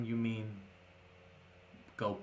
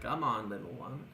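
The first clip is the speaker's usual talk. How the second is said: put-on coaxing old man. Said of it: "he definitely is like".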